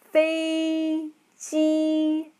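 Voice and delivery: 飞机 held high and level; 飞 and 机 both flat at a high pitch; 飞机 as fēijī, two level high tones; first tones. Shown as a demonstration. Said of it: "First tone, first tone. Flat".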